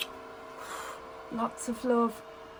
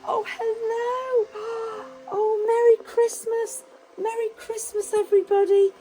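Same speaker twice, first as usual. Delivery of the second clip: bright, sing-song greetings to each toy, gasping with delight; children's entertainer voice, big and eager; excited kids' presenter; 8 semitones higher, 4 dB louder than usual. Acted. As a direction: wow the little ones as you greet every guest. Kids' Christmas show presenter.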